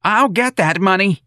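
high, loud, drawn-out yell; screaming. A narrator's reading. Mrs. Riley screaming at her son about money, furious. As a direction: scream it in fury, stretching it out. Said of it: "Missus Riley screamed".

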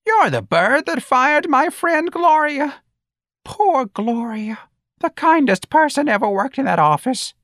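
put-on very high, slow, mournful old-lady voice; accusing old woman. A narrator's reading. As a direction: accuse him, drawing the words out high and slow.

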